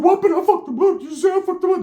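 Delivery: very high mimicked yelping; mock frantic protest. Ricky still getting socked while boasting, high mock frantic protest. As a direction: imitate a worked-up fighter squealing. Imitating Ricky mid-fight.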